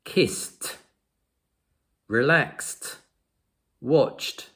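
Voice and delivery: "Kissed, relaxed, watched" each ending in an unvoiced "t". Shown as a demonstration. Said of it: "the E D sounds like a t".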